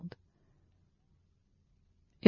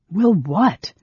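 loud, high mocking challenge; sharp jeer, pitched up; 5 semitones higher, 7 dB louder than usual. A narrator's reading. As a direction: throw it back at him derisively. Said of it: "Steele mocked him".

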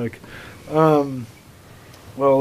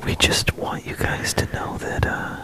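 hushed sultry whisper; ASMR whisper; 8 semitones higher, 11 dB quieter than usual. Pretend. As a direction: breathe the words softly. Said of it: "a little A S M R".

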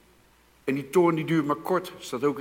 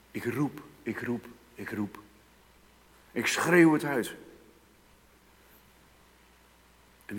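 praying plea; 7 dB louder than usual. Acted. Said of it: "En mogen zeggen".

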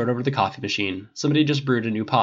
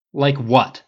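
louder, irritated retort; snapped; 3 semitones higher, 6 dB louder than usual. A narrator's reading. Tony firing back at pushy Harris, snapping. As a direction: snap the question back at him. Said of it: "Tony snapped".

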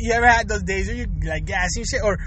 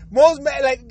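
ordinary delivery

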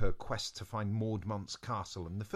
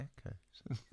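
echo; 3 semitones higher, 9 dB quieter than usual. Shown as a demonstration. Demonstrating echoes.

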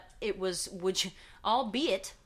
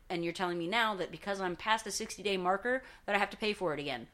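ordinary talk

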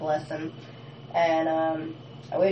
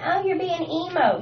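mocking emo taunt; 10 semitones higher than usual. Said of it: "bitching to me saying".

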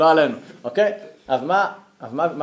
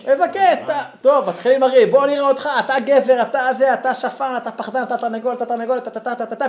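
schoolyard taunting; 9 semitones higher, 6 dB louder than usual. Retold or imitated. Kids taunting before a fight.

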